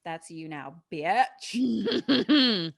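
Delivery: lightly voiced gloating villain, loud on goodbye and bitch; hammed-up gloat at his captive. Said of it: "Daddy Cox was like".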